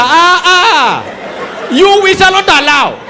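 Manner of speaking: high, loud official telling off the pilot; shouted scolding. Acted. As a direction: bark it out like a furious traffic officer. Acted official scolds over phone.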